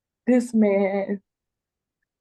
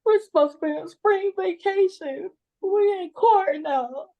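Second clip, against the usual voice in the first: crying; 9 semitones higher than usual. Real, not acted. Grandmother distressed before judge.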